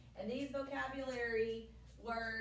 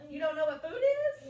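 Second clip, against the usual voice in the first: louder, higher mock shock; mock disbelief; 5 semitones higher, 10 dB louder than usual. Real, not acted. Teacher teasing the class over not knowing food, mock shock. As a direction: feign amazement that they don't know food.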